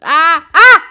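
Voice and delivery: short, loud high "ah"; game-control shouts. Real, not acted. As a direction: bark sharp vowels to make it jump. Short shouts to make character jump.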